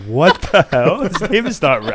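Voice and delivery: confused outburst. Retold or imitated. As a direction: blurt it out, baffled. Friends confused, retold reaction.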